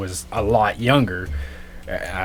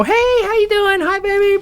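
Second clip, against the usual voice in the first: sweet mom greeting. Retold or imitated. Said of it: "she comes home from work".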